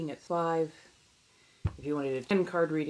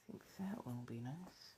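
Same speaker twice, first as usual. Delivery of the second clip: soft whispered aside; whispering; 4 semitones lower, 16 dB quieter than usual. Real, not acted. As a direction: whisper it to yourself. Same words whispered as retake.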